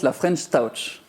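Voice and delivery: "French Touch" lent, à l'anglaise, en rajoutant; accent anglais appuyé. Pretend. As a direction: dis "la French Touch" très lentement avec un accent anglais caricatural. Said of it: "J'essaie une troisième pour la route". (le chroniqueur tente encore "la French Touch" avec un accent anglais forcé et lent)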